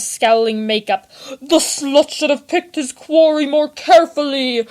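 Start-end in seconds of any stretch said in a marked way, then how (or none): 1.4-4.7 louder, higher mocking roar; mocking baritone growl. A narrator's reading. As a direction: sneer it over the corpse. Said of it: "roared the victor in a mocking baritone growl".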